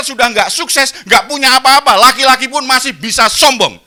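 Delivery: loud, high, driving sermon voice; full-force preaching. Acted. Preacher driving home his point to the congregation, loud and high.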